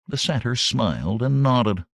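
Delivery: ordinary delivery, nothing marked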